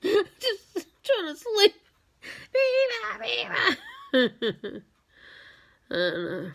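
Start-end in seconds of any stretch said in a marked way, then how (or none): 0.0-1.7 1 laughing complaint. Retold or imitated. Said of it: "And you're like". 2.5-3.8 high chirpy bird call; high mimicked birdsong; bird call imitation. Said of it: "would go".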